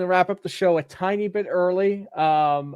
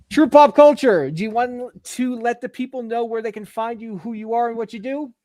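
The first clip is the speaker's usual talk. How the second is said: loud, higher host announce of the name; announcer intro; 7 semitones higher, 5 dB louder than usual. Acted. Host introduces the guest, loud announcer call.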